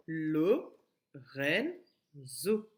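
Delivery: "Lo, ren, zo" spaced out, one syllable each. Shown as a demonstration. Teacher repeats the correction, "Lo, ren, zo" in separate syllables. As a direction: count out "Lo, ren, zo" with pauses. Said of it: "La première syllabe".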